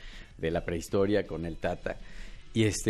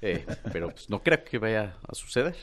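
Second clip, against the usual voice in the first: halting politician impression, long pauses mid-sentence; Ebrard. Comedian answers host as the politician in his stage show, halting impression. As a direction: drag the words out, hesitating between phrases. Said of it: "¿cómo habla Ebrard?".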